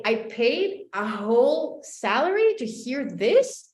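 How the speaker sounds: ordinary talk, nothing marked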